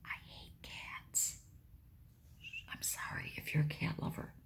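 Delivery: real whispered aside to viewers. Real, not acted. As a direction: lean in and whisper it like a secret.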